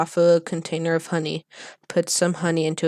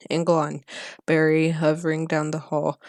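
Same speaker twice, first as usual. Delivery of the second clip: plain reading voice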